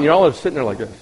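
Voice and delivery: usual speaking voice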